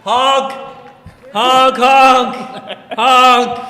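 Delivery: loud, high playful honks; silly clown honking. Pretend. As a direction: shout honk like a clown horn. Honking at own sign joke.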